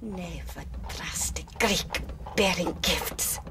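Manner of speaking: soft, unvoiced whisper of a threat; chilling whisper. Sophie whispering her verdict over the dead man. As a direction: breathe it like a curse. Sophie's final line after killing.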